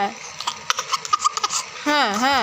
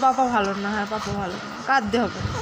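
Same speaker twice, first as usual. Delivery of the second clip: soothing; 10 semitones lower, 6 dB quieter, faster than usual. Real, not acted. Mother soothing upset baby.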